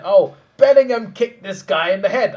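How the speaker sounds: high, loud imitation of an outraged fan; hammed-up accusation, pitched high and loud; mock outraged cry. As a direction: cry it out slowly, mimicking his outrage. Retelling a biased man's outcry.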